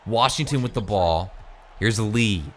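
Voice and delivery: esports caster calling the action; play-by-play. Acted. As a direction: call the game live, building on big plays. Live video game play-by-play.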